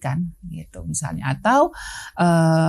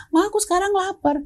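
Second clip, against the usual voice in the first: put-on whining hungry child; 12 semitones higher than usual. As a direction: whine like a hungry kid.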